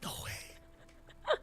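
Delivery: whisper. Retold or imitated. Retold disbelief at collab yes.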